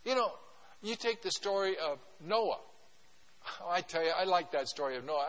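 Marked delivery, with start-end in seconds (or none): none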